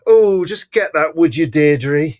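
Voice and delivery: put-on high-pitched mother calling to a child; offhand mother. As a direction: toss out a casual request, high and drawn out. Acts out mother's casual request.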